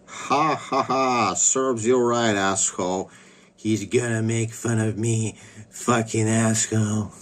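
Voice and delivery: cartoonish mocking voice with a forced laugh; Ontiria. Streamer mimics a woman gloating at him, cartoon-character impression. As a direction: put on a silly, drawn-out cartoon voice. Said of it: "I always get this, like, voice".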